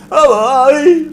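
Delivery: high, very loud garbled yelp; hammed-up mangled shout; mock slurred singer. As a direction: imitate a singer yelping the hook unintelligibly. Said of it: "got a quite of a speech impediment".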